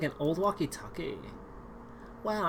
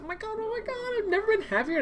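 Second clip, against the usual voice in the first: "oh my god" squealed much higher; excited squeal. Acted. Realizing someone can find her, a high excited squeal. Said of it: "same words squealed high".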